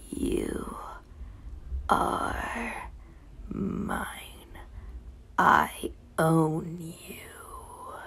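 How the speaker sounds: soft, slow whispered threat into her ear; husky whisper. Acted. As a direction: breathe it right into the ear, possessive. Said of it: "he growls, a low, husky voice".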